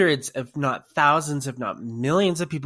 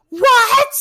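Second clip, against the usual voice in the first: very high, loud scream of disbelief; reenacted astonished squeal; stunned shriek; 14 dB louder than usual. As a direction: shriek it in thrilled shock. Retold stunned reaction to news.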